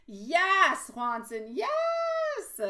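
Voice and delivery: delighted welcome to a friend; excited yes. Real, not acted. Excited greeting of a viewer.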